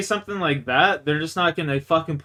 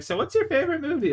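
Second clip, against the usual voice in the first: much higher, fast, lightly mocking woman's voice; mock girly voice; 11 semitones higher, faster than usual. Mocking the woman's post-sex question in a high voice. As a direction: parrot her question in a high mocking lilt. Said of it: "She's like".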